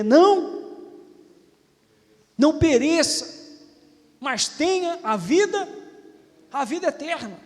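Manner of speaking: loud, ringing pulpit preaching; fiery preaching. Acted. Pastor preaching loudly to his congregation. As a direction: preach it hard to the church.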